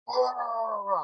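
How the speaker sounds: imitated wordless yell, high and drawn out; angry mumbled roar. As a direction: mimic someone mad and impossible to understand. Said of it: "I hear him mumbling, and he's just getting really mad".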